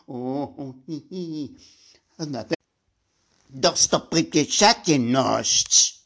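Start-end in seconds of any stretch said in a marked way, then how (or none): 3.5-6.0 loud. Acted. Loud, emphatic long word.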